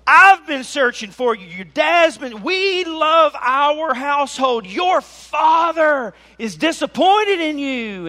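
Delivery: high, loud, drawn-out berating; hammed-up parental scolding. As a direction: shout at your son like a wounded parent, theatrically. Acted scolding by distressed parent.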